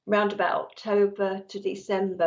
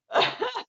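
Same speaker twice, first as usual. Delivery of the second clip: short, high-pitched laugh; amused little high laugh. Real, not acted. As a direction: let out a quick high laugh. Laughs at own chattering.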